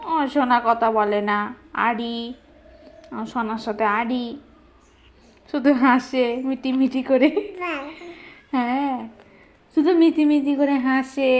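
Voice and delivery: put-on low, pouting baby-talk grumble; mock sulk. Parent declaring a tiff with silent baby, mock sulk. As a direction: drop low and pretend to be offended.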